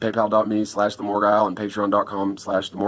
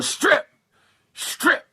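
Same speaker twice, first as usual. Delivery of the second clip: sharp high yelled order, the second gone to a breathy rasp; barking at them to strip as he remembers it; retold yell. Intruder yelling orders at the couple, a sharp high shout. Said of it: "he was yelling".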